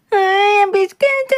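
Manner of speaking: put-on high wailing child voice; a small kid howling over a stolen biscuit; crying child.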